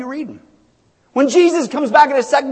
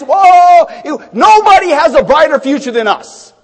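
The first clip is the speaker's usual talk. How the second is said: louder whoa, then a shout; loud excited cry; 2 semitones higher, 6 dB louder than usual. Acted. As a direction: shout the good news. Preaching excitement.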